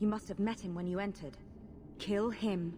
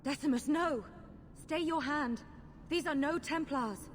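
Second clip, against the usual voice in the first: desperate call; 7 semitones higher than usual. Acted. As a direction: cry out to stop him. Pleading to stop the attack.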